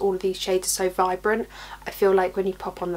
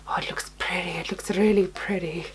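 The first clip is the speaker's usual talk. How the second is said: hushed admiring whisper; 2 semitones lower than usual. Real, not acted. Admiring close up.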